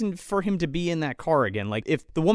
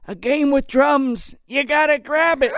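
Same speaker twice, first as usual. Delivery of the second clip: mock pleading old woman; 8 semitones higher, 3 dB louder than usual. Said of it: "and she's like".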